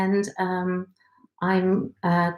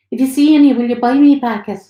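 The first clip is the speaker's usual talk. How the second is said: higher, eager pleading for sweets; a hopeful craving woman begging a treat; eager pregnant Marilyn; 5 semitones higher, 6 dB louder, faster than usual. Acted. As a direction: pregnant woman asking her partner for caramels, higher and eager.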